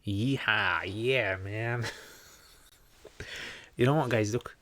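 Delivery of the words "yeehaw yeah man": stretched-out, exaggerated American twang; mock American accent. Shown as a demonstration. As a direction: ham up an American cowboy accent. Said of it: "you want me to speak in a way".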